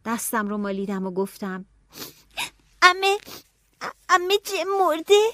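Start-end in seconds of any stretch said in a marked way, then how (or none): 2.8-5.2 high, loud, anxious question; a scared child asking the worst; frightened. Acted. Child fearing her brother dead. (با ترس و نگرانی)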